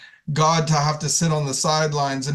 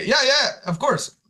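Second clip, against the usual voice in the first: eager agreement; 9 semitones higher than usual. Acted. Peter eagerly agrees again.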